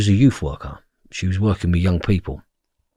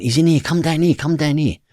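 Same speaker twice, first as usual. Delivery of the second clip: high, urgent summons; retold urgent beckoning; urgent calling; 7 semitones higher than usual. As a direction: call someone over in a hurry. Brother calling down the corridor.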